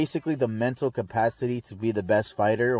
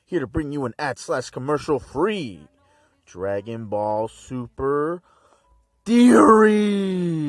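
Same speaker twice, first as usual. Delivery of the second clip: eerie hammed-up announcer voice; creepy voice; 3 dB louder than usual. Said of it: "Sorry for the weird, creepy voice".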